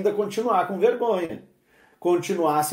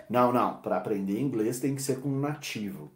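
low, soft mock insistence; a hint of a stubborn learner; 6 semitones lower, 6 dB quieter than usual. Imitating the student who insists on a native teacher, low and soft. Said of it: "que você insistia".

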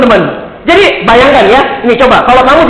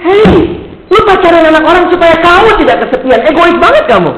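high-pitched exasperated retort; acted rebuke, insistent and high; mock scolding; 8 semitones higher than usual. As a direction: tell the kid off, pitched well up. Retold rebuke to the boy.

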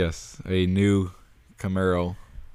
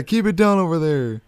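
cranky neighbor; 9 semitones higher than usual. Retold or imitated. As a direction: shout it across the yard, annoyed. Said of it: "They're like".

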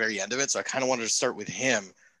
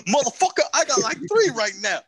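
higher, louder mock impression; 11 semitones higher, 4 dB louder, faster than usual. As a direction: push the voice up in a loud mock.

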